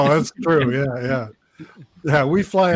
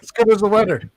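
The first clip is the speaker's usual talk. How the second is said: higher-pitched mock Newfoundland accent; imitated Newfoundland accent; 6 semitones higher, faster than usual. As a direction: repeat it in an imitated Newfoundland lilt. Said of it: "a whole different language in Newfoundland".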